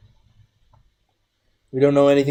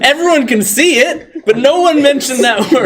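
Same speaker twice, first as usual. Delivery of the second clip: higher, giggly insistence; laughing; 10 semitones higher, 5 dB louder than usual. Real, not acted. Player teases the table about the dwarf, laughing. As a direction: laugh through the joke.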